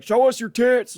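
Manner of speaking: put-on a drunk guy shouting up at the stage, pitched high and loud; drunk heckler yell. Drunk bar patron heckling a female comic, loud mock yell. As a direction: bellow it like the rowdy drunk in the back. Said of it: "he's like".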